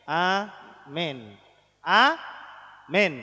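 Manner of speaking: amin as "a... men", with a pause between; syllable-by-syllable demo. Shown as a demonstration. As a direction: split "amin" into a, then men. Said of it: "Memnya jadi me". (amin dieja pelan "a... men")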